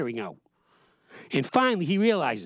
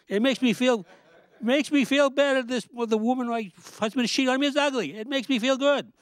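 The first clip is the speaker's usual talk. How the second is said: high, giggly mock relief; hammed-up gleeful wife; 9 semitones higher than usual. The cheated wife gloating about the ugly rival, high and giggly.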